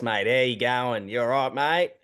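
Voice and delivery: imitated country Aussie twang, drawn out; broad Aussie twang. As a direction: put on a thick, slow Australian twang. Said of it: "Aussie twang, they speak like this".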